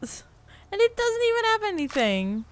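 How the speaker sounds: high, fast outraged complaint; indignant. Real, not acted. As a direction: squeak out your disbelief. Indignant at empty loot.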